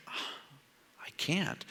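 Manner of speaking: soft breathy "I can't"; a guilty hushed no; whispered refusal. Pretend. Preacher whispering his own refusal to the Lord.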